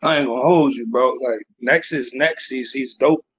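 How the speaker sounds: usual speaking voice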